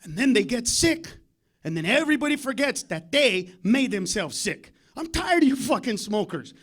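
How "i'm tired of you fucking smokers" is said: ordinary talk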